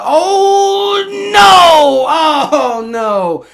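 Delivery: loud, high, drawn-out oh no; alarmed cry. Real, not acted. Streamer watching the punt bounce, alarmed cry. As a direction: cry out as the ball bounces away.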